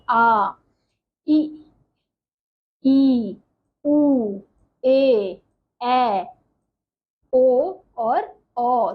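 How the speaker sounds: each vowel आ ई उ ए ऐ ओ औ held twice as long; long vowels drawn out. Shown as a demonstration. Teacher shows the class the long vowels, drawn out slowly. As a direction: stretch every vowel, slowly and clearly. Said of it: "ह्रस्व स्वर से दो गुना समय".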